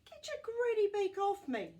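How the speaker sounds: high, loud frantic wail. Acted. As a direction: wail at the bird in panic. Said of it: "Oscar wailed".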